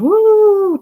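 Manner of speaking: high, loud howling "Woo"; wolf howl. Acted. Reading to kids, howling like a wolf.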